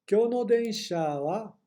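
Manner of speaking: slow, careful model sentence; slow model reading. Shown as a demonstration. Teacher reading slowly for students. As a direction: read it slowly for the class.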